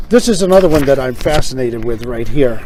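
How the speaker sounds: ordinary delivery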